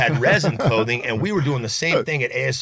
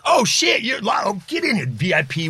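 mock excited doorman; 8 semitones higher than usual. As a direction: welcome him in with a burst. Doorman recognizes him.

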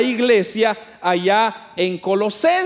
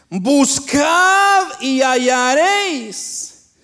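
slow, loud, drawn-out scripture proclamation; scripture proclaimed in preaching; 3 semitones higher, 4 dB louder, slower than usual. Acted. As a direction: proclaim each command to the whole congregation, stretching it. Proclama la cita bíblica. (proclamación bíblica lenta, fuerte y alargada)

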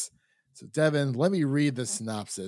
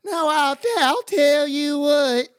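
much higher, louder hammed-up West Virginia drawl, slow; Southern accent; 8 dB louder, slower than usual. Co-host asks for a Southern accent, so a hammed-up high drawl. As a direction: ham up a Southern Ohio drawl for your co-host. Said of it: "that Southern Ohio, West Virginia accent".

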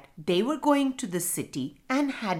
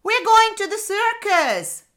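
put-on high, loud children's squeal; shrill burst of excitement; excited shriek; 14 semitones higher, 8 dB louder than usual. A narrator's reading. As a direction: shriek it like thrilled kids. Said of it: "shrieked the children in excitement".

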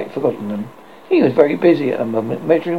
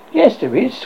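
eager cry; 7 semitones higher than usual. Acted. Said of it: "cried Peter".

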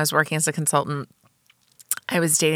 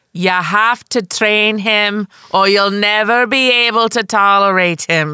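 slow, louder, emphatic Jamaican cabbie impression; Jamaican cabbie accent; 4 semitones higher, 5 dB louder, slower than usual. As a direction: lay down advice in a rough Jamaican accent. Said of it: "she was Jamaican".

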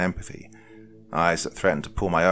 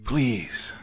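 higher, pleading word; begging; 6 semitones higher than usual. A narrator's reading. Desperate man begging the group, pleading. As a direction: plead helplessly. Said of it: "Wyatt begged".

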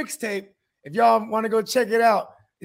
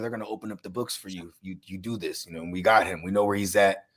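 low mobster promise; 12 semitones lower than usual. Retold or imitated. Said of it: "they were like".

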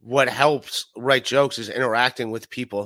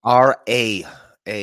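R A spelled out firmly with A punched louder; stress on A; 2 semitones lower, 4 dB louder, much slower than usual. Real, not acted. Correcting a guest's misspelled channel name, stressed A. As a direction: hit the A hard to correct a misspelling.

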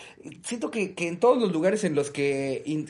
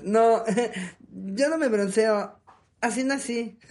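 awkward laugh; 6 semitones higher, slower than usual. Retold or imitated. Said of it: "toda incómoda y entre risas".

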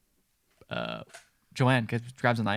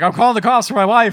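high, loud, indignant threat; hammed-up shouted announcement; retold yelling; 8 semitones higher, 13 dB louder than usual. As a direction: yell it as the worked-up husband. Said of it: "He just starts yelling".

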